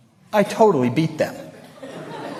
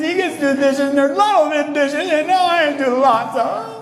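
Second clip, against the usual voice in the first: put-on much higher, whining kid's voice; whiny complaining; 12 semitones higher than usual. Child told to do dishes whines to parents, high and aggrieved. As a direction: whine like a sulky kid stuck with chores. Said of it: "And you used to go".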